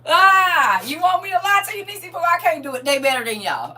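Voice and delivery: loud, high-pitched exclamation; exasperated outcry. Real, not acted. Grandmother refusing to lie about favouring grandkids, exasperated outcry. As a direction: cry out in playful protest, very high and loud.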